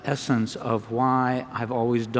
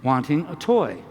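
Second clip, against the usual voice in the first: ordinary talk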